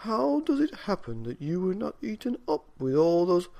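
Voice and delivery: put-on old man wondering at the kitten; old man asking.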